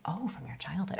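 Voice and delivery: whispered reaction; hushed intrigue. Acted. Guest plays the listener who won't interrupt, intrigued whisper. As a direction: drop to a whisper, as if drawn in.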